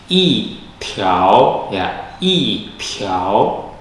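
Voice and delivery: i tiao with a falling i, the repeat drawn out very slowly; model i tiao. Shown as a demonstration. Teacher demonstrates yi tone sandhi to students, slowly. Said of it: "i berubah menjadi nada empat".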